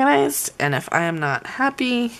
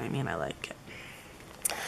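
whispering; 12 semitones lower, 16 dB quieter, slower than usual. Real, not acted. Whispered aside to self.

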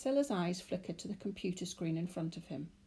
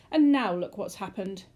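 despairing; 9 dB louder than usual. A narrator's reading. Man despairs at wrecked car.

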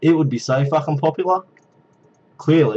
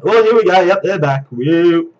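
louder, higher, thrilled; excited; 4 semitones higher, 6 dB louder than usual. Real, not acted. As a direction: cheer as they come back. Zombies return.

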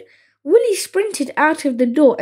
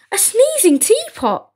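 high-pitched incredulous exclamation; amazed; 8 semitones higher than usual. A narrator's reading. Flea at the sneezing teapot, amazed. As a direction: marvel at it in a high voice.

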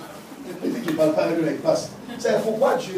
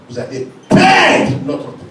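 much higher, louder, slow warning; louder repeat; 10 semitones higher, 5 dB louder, slower than usual. Acted. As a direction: drive it home with a raised voice.

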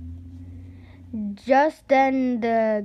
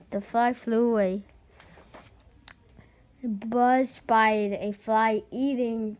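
ordinary delivery

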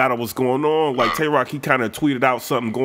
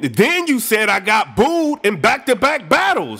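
mock pissed-off rant; 8 semitones higher than usual. [name] complains to [name], high and exasperated. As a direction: vent betrayal at a friend. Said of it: "So [name] is pissed".

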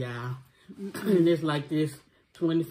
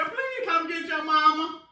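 calling out; 11 semitones higher, 4 dB louder than usual. Real, not acted. Calling out while choking.

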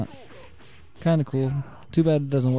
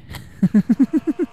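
high-pitched rapid giggle; 10 semitones higher, 4 dB louder than usual. Real, not acted. Amused after beating opponent.

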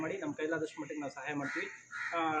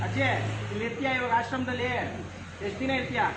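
raised voice to elder; 4 semitones higher than usual. Real, not acted. Host asks grandmother whether she will stay, in a raised voice. Questioning an elderly woman directly.

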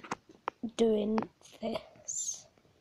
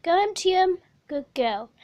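pet coaxing; 6 semitones higher, 10 dB louder than usual. Real, not acted. Coaxing and praising hamster.

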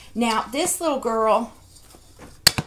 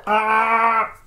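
loud drawn-out creature roar; Chewy roar; 6 dB louder than usual. Acted. Collector showing a bendable Chewy toy, roaring impersonation. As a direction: let out a sustained mock Wookiee bellow. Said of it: "That was my Chewy impersonation".

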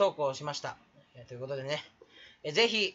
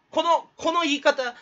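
imitating a YouTuber; 7 semitones higher, 6 dB louder, slower than usual. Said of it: "メグインさんです".

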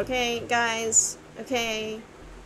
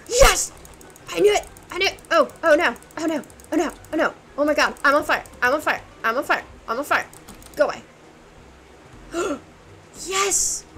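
loud, high, thrilled yelling; excited shouting; 4 semitones higher, 6 dB louder, faster than usual. Real, not acted. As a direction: yell it out in the heat of battle. Sudden fight in game.

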